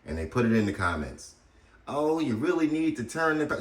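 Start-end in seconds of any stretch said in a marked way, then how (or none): 1.9-3.6 high-pitched mock nagging; nagging commenter imitation. Man parroting complaints from his video comments, mock nagging. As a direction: mimic a fussy know-it-all, voice pushed up high.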